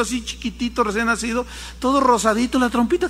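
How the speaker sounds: ordinary delivery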